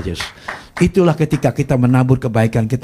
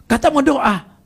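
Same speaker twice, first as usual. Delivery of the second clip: high-pitched sister telling him off; mock-scold in a much higher voice; imitated scolding; slower than usual. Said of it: "Dimakin lagi".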